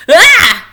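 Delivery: high, loud startled squeal; squeal of surprise. Acted. Littlest Rabbit squealing in shock at the talking nut. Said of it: "squealed Littlest Rabbit".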